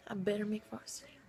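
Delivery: fast determined whisper. Real, not acted. Muttering her goal to herself in a whisper. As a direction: breathe it out like a secret vow.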